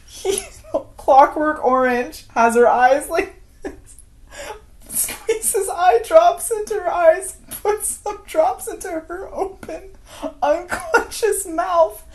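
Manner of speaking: high, strained voice fighting off laughter; trying to talk through the giggles; laughing. Real, not acted. Cracking up while retelling.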